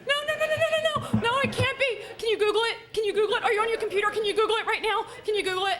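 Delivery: high, loud frantic begging; panicked pleading. Retold or imitated. Wife begging her husband on the phone, frantic and shrill-high. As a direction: beg him in a rising panic.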